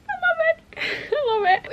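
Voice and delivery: very high, imitated tearful gushing; mock swoon, squealed with a laugh. Reader reliving the last part of the book, gushing. Acts out reaction to book ending.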